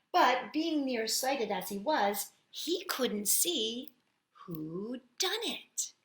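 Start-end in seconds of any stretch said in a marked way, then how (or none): none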